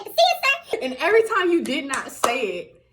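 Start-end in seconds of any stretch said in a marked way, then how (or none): 0.0-0.8 high-pitched mock recruit call; recruit's response. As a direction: sound off in an exaggerated high voice. Recruits' forced response, acted.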